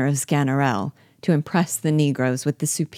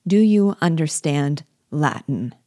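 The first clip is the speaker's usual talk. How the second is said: plain reading voice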